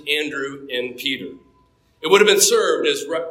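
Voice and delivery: usual speaking voice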